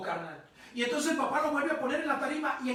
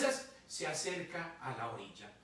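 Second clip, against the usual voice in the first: normal talking voice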